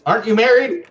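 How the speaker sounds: high-pitched puzzled query; surprised question. Acted. Kenneth to Maya after she calls him beautiful, surprised question. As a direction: ask it high, taken aback.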